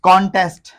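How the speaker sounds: कॉन्टेस्ट loud as KON-test; full open O in कॉन्टेस्ट; wrong pronunciation. Shown as a demonstration. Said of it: "totally गलत है". (कॉन्टेस्ट ग़लत, कॉन-टेस्ट)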